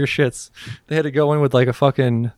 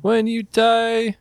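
mock grand proclamation; 7 semitones higher, much slower than usual.

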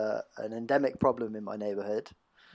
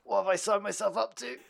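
usual speaking voice

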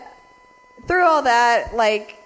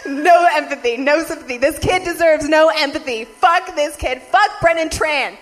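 loud, high, emphatic rant; angry rant; 7 semitones higher, 6 dB louder than usual. Real, not acted. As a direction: hammer each line.

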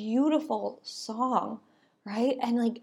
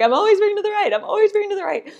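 much higher, louder frustrated self; exasperated inner voice; 10 semitones higher, 5 dB louder, much faster than usual. Retold or imitated. Driver whose car drifts right, complaining in a high frustrated voice. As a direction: squeak it out, fed up with yourself.

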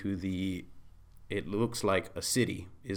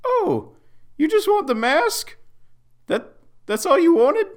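very high, loud squeaky voice; high squeaky Pinkerton voice; 13 dB louder than usual. Acted. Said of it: "Pinkerton speaks up".

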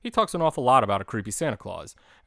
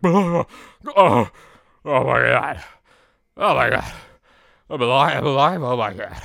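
much louder, frantic gasping relief; panicked waking; 4 semitones higher, 11 dB louder than usual. Retold or imitated. Big Bird waking up frozen on the roof, loud and frantic. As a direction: burst awake shouting that you're alive.

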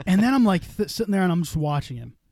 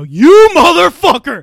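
imitating his own slow, much higher and louder yell; retold yell; 12 semitones higher, 18 dB louder, much slower than usual. Replying to the streamer's winky face, slow high outraged yell.

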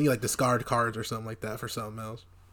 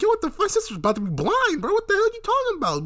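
very high, loud mock outrage; outraged shriek; 5 dB louder than usual. Joey defending his sister, squealing outrage. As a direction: squeal it in hammed-up fury. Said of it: "Joey was like".

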